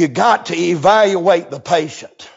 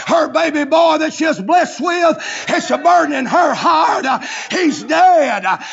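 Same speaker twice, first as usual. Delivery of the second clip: preaching at full force; 7 semitones higher than usual. Acted. Preacher shouting the story to the church. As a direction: whoop it out to the congregation.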